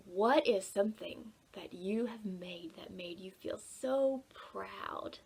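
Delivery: ordinary talk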